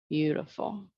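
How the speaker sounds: ordinary talk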